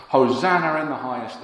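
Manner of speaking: loud, high cry of praise; crowd shout. Retold or imitated. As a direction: call it out like the whole crowd. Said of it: "they shout".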